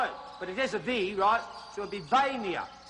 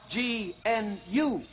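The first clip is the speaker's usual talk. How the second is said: G N U spelt slowly letter by letter; spelling out; much slower than usual. Shown as a demonstration. Host spelling the gnu for viewers, slowly. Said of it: "the new is spelt".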